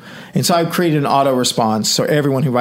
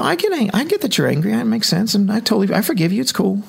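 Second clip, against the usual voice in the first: higher, sweetly placating retold reply; smooth it over gently, pitched up; soothing forgiveness; 8 semitones higher than usual. Replying to an angry emailer, mildly soothing and higher.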